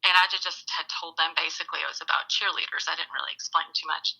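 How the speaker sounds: ordinary talk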